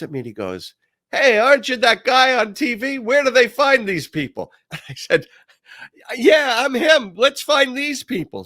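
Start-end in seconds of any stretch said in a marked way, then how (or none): 1.1-4.5 high and loud, starstruck; a sheriff thrilled to spot a TV guy; high loud starstruck question; excited recognition. Retold or imitated. 6.1-8.4 high, loud and slow, playing the hero; proudly rally the sheriff; high loud slow mock-heroic line; gung-ho reply.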